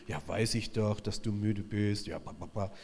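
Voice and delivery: a sulky, deflating parody; mock weary brush-off; mock dismissive God. What God does not say.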